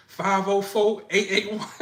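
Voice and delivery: high, giggling mock surrender; comic nervous recital; flustered compliance. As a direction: hand it over meekly while laughing. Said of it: "You be like this".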